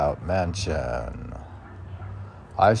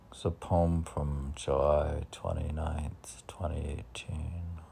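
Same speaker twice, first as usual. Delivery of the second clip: soft breathy whisper of the date; quiet murmured aside; 3 semitones lower, 7 dB quieter than usual. Real, not acted.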